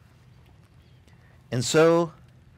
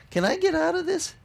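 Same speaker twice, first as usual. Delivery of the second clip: high pleading squeak, asking to get out of it; a comic high whine; mock whiny Moses. Moses begging God to let him quit, high whine. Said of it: "He's like".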